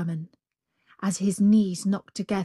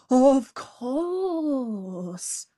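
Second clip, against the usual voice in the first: higher, faked trembling cry; mock fright; 6 semitones higher than usual. The jackal fakes fear before the tiger, higher. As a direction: pretend to quake. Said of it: "pretending to tremble with fright".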